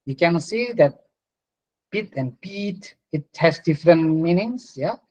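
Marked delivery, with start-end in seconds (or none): 1.9-3.0 pit with a short clipped i, pete with a long stretched ee, said slowly; short versus long vowel. Shown as a demonstration. Teacher showing the class vowel length, slowly. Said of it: "short and long vowels".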